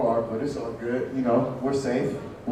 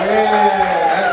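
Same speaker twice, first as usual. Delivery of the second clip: usual speaking voice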